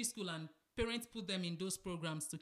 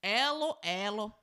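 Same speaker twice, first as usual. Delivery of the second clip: put-on Nigerian accent; 5 dB louder, slower than usual. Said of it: "He had thick Nigerian accent".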